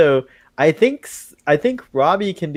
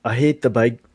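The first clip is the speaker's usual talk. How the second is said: put-on angry Scottish accent. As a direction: spit out hate in a cross Scottish accent. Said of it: "I don't know why it's angry".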